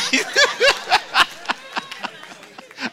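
long high laugh out loud; real laughter. Real, not acted. Preacher laughing.

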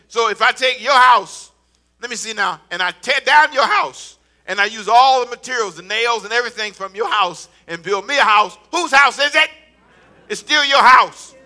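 loud, rising sermon declarations; full-force preaching. Acted. Pastor rousing the congregation, loud preaching. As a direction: drive it home to the crowd.

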